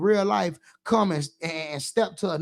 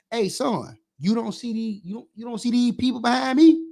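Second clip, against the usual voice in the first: put-on scolding a cussing kid; 3 semitones higher than usual. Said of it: "Step down on your ass".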